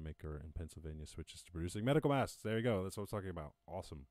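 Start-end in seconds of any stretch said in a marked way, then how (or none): none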